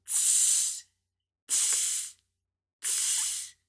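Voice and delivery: long sustained "tss" hiss; stretched-out C sound. Shown as a demonstration. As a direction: draw "tss" out as a voiceless ts. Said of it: "The C is more stretched out".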